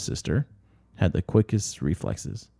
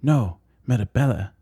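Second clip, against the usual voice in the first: a hint of a stern nun scolding, higher; imitating the nuns; 6 semitones higher, 3 dB louder than usual. Wolf girls mimicking nuns scolding Marabella, mock stern. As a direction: scold her name like a nun would. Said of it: "imitating the nuns".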